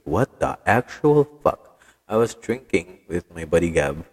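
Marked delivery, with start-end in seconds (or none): 0.1-1.8 slow, drawn-out and higher, louder in disbelief; shocked disbelief. Retold or imitated. Retelling the moment he saw the shooting video, slow stunned disbelief. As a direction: stretch it out like you can't believe the video. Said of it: "I was like".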